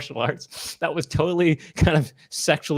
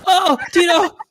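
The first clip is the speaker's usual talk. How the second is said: high wailing moans; hammed-up pitiful calls for help; mock crying; 10 semitones higher than usual. Imitating a man crying to Tito, mock crying. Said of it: "He's crying".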